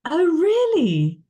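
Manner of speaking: genuinely taken aback, drawn out; real surprise. Real, not acted. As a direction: caught off guard by the news. Host reveals surprising connection.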